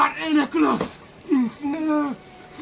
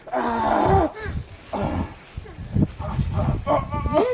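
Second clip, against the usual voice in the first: usual speaking voice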